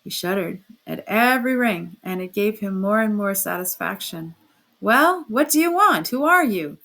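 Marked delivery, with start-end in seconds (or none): 4.8-6.8 loud startled shout at a stranger; workman shouting. A narrator's reading. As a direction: bark it out from the doorway. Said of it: "the workman shouted".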